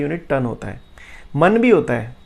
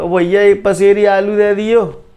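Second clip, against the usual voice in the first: louder, slow market call in village dialect; calling to a vendor; 4 semitones higher, 7 dB louder, slower than usual. Retold or imitated. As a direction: shout your order across the stall. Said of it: "जैसे भाई तुम मंडी जाते हो".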